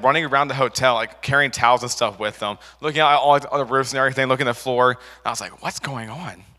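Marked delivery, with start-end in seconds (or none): 5.6-6.4 much softer, puzzled question; bewildered question. Retold or imitated. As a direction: ask it under your breath, lost. Confused boy wandering the hotel.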